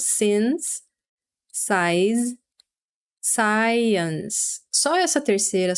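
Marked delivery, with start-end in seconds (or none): 0.0-4.6 three words said slowly one by one, science with two syllables; contrasting since, size, science. Shown as a demonstration. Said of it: "você vê que as outras duas". (since, size e science, pronunciados com contraste)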